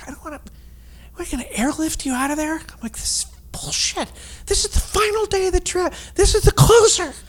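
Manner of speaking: high, breathy whisper yell; hiss-shout it like a furious whisper; stage whisper. Retold or imitated. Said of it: "he doesn't whisper yell in real life".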